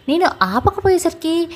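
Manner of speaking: ordinary delivery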